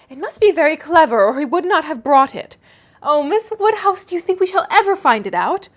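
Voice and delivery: put-on excited naive girl begging for help; eager young Harriet.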